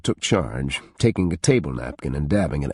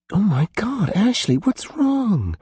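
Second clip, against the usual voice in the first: shocked. Acted. Friends shocked by Ashley crying.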